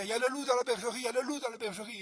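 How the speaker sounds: alerte criée, aiguë et forte; chien qui hurle. Acted. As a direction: crie l'alarme de toutes tes forces. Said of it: "Il entend ce chien". (le chien de garde crie l'alarme)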